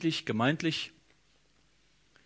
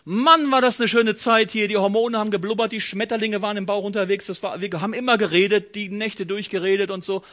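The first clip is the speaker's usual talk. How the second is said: fast, loud, higher-pitched rush of memories; gushing nostalgia; 4 semitones higher, 6 dB louder than usual. Acted. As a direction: gush about being head over heels. Acted nostalgia of couple in love.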